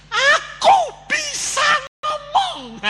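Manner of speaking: very high, slow, drawn-out shout; astonished shout. Acted. Mute wife discovers she can speak, shouting high and slow. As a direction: cry it out like a miracle, each word stretched.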